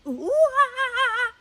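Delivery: high, loud monkey ooh-ah calls; monkey noises. Pretend. Host showing the baby what a monkey says, loud high monkey calls. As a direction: hoot like an excited monkey. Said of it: "He goes".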